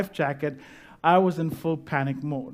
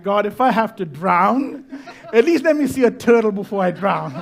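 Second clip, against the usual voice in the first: laughing mock prayer; 3 semitones higher, 6 dB louder than usual. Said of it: "praying like never before".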